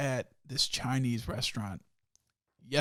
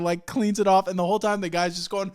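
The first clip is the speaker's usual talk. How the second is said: normal talking voice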